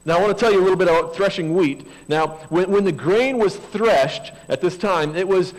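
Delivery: sermon scripture reading. Acted.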